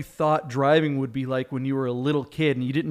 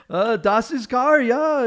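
high, slow put-on German accent; mock German accent; 9 semitones higher, 4 dB louder, slower than usual. Tourist caught in the car, answering in a slow German accent. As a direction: play a confused German tourist, slowly, in a German accent.

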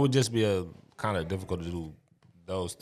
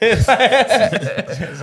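laughing; 12 semitones higher, 11 dB louder than usual. Real, not acted. Joking about Greece movies.

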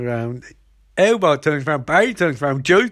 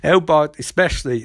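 ordinary talk

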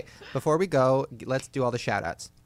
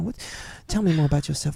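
ordinary talk, nothing marked

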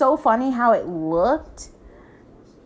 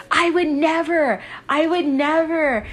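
high, affronted imitation of denial; retold protest, pitched up and emphatic; mock indignant denial; 6 semitones higher, 3 dB louder than usual. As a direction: imitate an offended girl denying it. Accused girl denies it.

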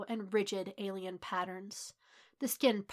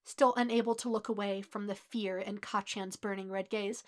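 usual speaking voice

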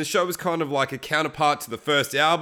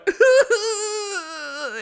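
put-on high wailing sob like a baby; mock baby crying; 3 dB louder than usual. JB after smashing his guitar, hammed-up baby wailing. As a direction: wail like a big baby, hammed up. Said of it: "he starts crying like a baby".

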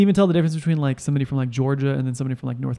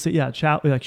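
ordinary delivery

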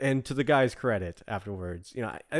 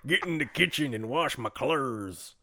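lightly voiced chauvinist husband, laughing through it; mock macho husband; 3 semitones higher than usual. Husband mocking his pregnant wife, light mock macho voice. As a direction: ham up a sexist husband scoffing at his wife. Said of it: "he probably didn't sound like this".

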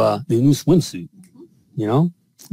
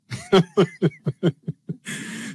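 a burst of real laughter, higher; 6 semitones higher, 4 dB quieter than usual. Real, not acted. Husband laughing at his own libido quip, real laughter.

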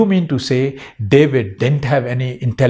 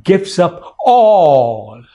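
"all" stretched out long and slow with a rising lift; stress on all; 3 dB louder, much slower than usual. Shown as a demonstration. Pastor stressing to the church that David gave up all, slow and drawn out. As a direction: lean hard on "all", drawing it out. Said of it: "I want to emphasize on the word all".

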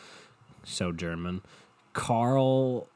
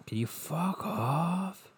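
slow, high, drawn-out mock request; exaggerated dismissal; 8 semitones higher, 4 dB quieter, much slower than usual. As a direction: stretch it out, pitch climbing on "off". Repeats the telling-off, exaggerated.

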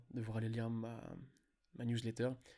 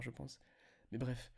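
ordinary delivery

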